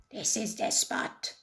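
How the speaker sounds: whispering pirate captain. A narrator's reading. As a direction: whisper it at the secret spot.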